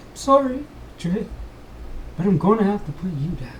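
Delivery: a king forced to do it; reluctant king. Acted.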